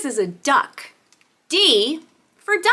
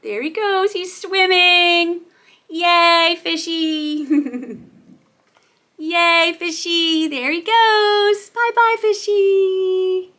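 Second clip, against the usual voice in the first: playful cheering; 3 semitones higher than usual. Pretend. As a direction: cheer a toy fish along, stretching the words. Cheers on the toy fish.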